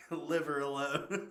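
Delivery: real laughter bubbling through the words; laughing. Real, not acted. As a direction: crack up while saying it. Laughing at the movie line.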